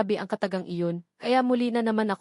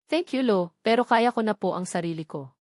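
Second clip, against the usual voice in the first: pleased reply. A narrator's reading. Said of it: "natutuwa kong sagot".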